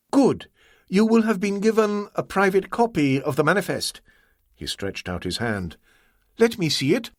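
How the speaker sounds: Ah Wong, demanding. Acted. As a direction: hold out a hand and demand.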